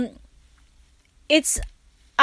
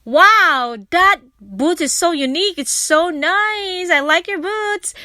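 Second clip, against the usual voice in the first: high, bright-eyed praise, a little louder; gushing compliment; 6 semitones higher, 3 dB louder than usual. Retold or imitated. Complimenting a woman's boots in a store, excited and high. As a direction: gush over a stranger's boots. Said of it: "I give her a compliment".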